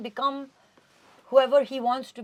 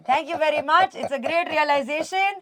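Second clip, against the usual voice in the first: louder and higher, hammed-up celebration; mock jubilant; 6 semitones higher, 13 dB louder than usual. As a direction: gush like accepting an award, half joking. Mock jubilant victory speech.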